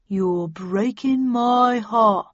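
very slow, loud mocking drawl; sarcastic. A narrator's reading. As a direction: drag it out, mocking. Dealer mocks Randolph.

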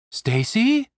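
soft calling voice from a dream; soft echoing dream voice. Acted. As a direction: call her name gently, as if from far away. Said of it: "a soft voice called out from the peaceful oblivion".

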